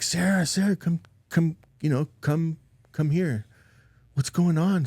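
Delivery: high, urgent mock yell; retold scream. Dad calling Sarah to the shaking baby, retold urgent yell. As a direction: re-enact calling for help, pitched well up but not at full volume. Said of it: "And I screamed".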